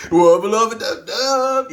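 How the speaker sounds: high, loud, slow cartoon voice; hammed-up cartoon catchphrase; Rick catchphrase. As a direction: shout it like a cartoon scientist.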